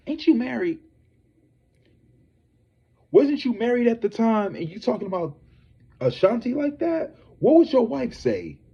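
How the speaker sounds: high, outraged rhetorical questions; mock outrage at a married man; incredulous scolding. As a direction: call him out in high disbelief. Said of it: "And I was like".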